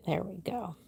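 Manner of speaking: quick whispered aside. Real, not acted. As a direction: whisper it under your breath. Aside while setting up chat.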